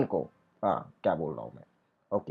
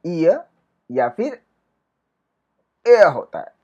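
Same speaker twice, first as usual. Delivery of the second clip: ordinary talk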